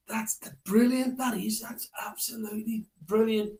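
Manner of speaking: a hint of excited children; kids praising.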